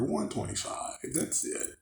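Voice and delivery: hushed, breathy under-the-breath price; a quiet whispered aside. Real, not acted. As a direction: drop to a whisper as if sharing a secret. Muttered aside about the store price.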